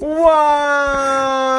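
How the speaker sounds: long, loud mock-crying wail; Nancy Kerrigan. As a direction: howl it high in fake agony. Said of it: "you turned me into Nancy Kerrigan".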